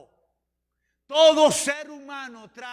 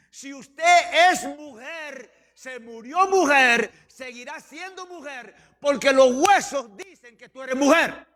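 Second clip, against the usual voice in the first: forceful, drawn-out sermon delivery; emphatic preaching; 4 semitones higher, 7 dB louder, slower than usual. Real, not acted. Preacher warns congregation about changing sex, emphatic preaching. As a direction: hammer each point home to the congregation.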